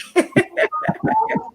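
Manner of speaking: high burst of laughter; big laugh. Real, not acted. Laughs at Nick's chipmunk voice.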